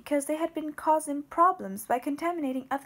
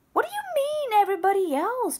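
loud, much higher angry retort; snapped; 8 semitones higher, 6 dB louder than usual. Acted. Babette throws his words back at the brother-in-law, loud high snap. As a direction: bite back hard and high. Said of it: "Babette snapped".

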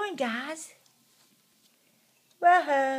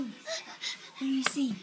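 slow cooing; 2 semitones higher, 6 dB quieter, slower than usual. Real, not acted. Cooing at baby.

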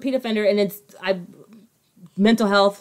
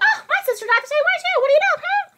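very high, quick, squeaky mockery; a friend mocking the liar's fake sympathy story; faster than usual. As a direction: squeak it out like a cartoon liar. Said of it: "she's like".

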